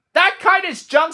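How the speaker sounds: high, mocking little bird voices; a robin heckling from the bush, high and mocking; jeering robins. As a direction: jeer at Toad like a cheeky robin. Robins mock third time.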